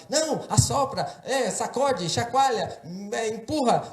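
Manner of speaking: high, insistent overlapping-style advice; clamoring bystanders. Retold or imitated. Onlookers each offering advice on the splinter, high and insistent.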